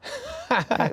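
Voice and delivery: imitated snoring; mock snore. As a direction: pretend to snore from boredom.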